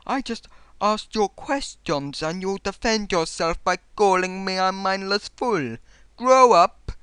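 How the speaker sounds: drawn-out, hammed-up indignant reading; mocking read-aloud. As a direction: read the comment out as a loud, exaggerated caricature. Reads hostile comment in put-on voice.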